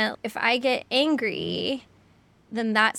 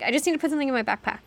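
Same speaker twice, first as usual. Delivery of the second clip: put-on hurried child; much faster than usual. Child rushing excuse.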